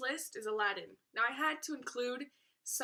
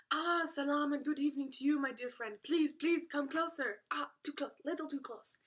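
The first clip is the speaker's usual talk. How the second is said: imitated merchant, raised pitch and animated; higher, put-on merchant patter; Robin Williams; 3 semitones higher than usual. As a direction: play a welcoming film merchant, drawing customers in. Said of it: "it was just Robin Williams talking".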